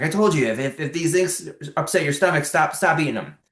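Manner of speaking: a mild mock yell, pitched well up; retold heated yell. GI doctor losing patience with a pushy patient, mock yelling. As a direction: imitate the doctor snapping back, half in earnest. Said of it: "got heated at me and yelled at me".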